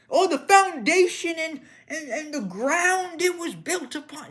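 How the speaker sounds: high, loud sneering mimic of an excuse; mock them in a whiny high voice; parroting the excuses for house prices, mocking; mocking whine.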